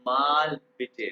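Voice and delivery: teacher models माल बिटे slowly; German phrase. Shown as a demonstration. Teacher shows students how to ask for a repeat, slow and clear. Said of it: "आपने मुझे कहना है".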